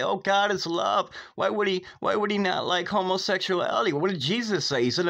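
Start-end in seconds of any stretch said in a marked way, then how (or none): 0.0-3.9 mocking the scoffers. Preacher mimicking those who defend sin, mock whine. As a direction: parody the people who argue back. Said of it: "those out there that say".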